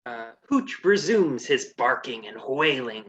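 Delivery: ordinary talk, nothing marked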